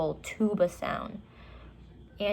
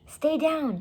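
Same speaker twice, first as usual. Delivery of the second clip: high, urgent hushed command; high urgent order; 9 semitones higher, 3 dB louder than usual. A narrator's reading. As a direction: keep her down fast. Urgent instruction near dinosaur.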